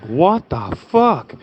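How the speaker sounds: slow disbelieving gasp of a curse; stunned outburst. Retold or imitated. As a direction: caught off guard, stretching each word. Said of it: "And I'm like".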